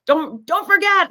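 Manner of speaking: a hammed-up proud daughter calling out; imitating the eager daughter. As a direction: play the excited girl pleading not to forget her mom. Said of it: "She, she kept running up".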